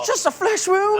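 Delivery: very high put-on falsetto; squeaky comic quote. Host caps tale of crawling monsters with a quote, squeaky mock defiance.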